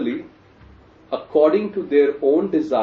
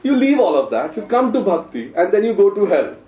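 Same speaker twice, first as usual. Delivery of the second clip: loud, high-pitched sarcasm; mock outrage at a rotten bargain; sarcastic; 7 semitones higher, 5 dB louder than usual. Real, not acted. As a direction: push the irony high and loud. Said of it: "understand my sarcasm".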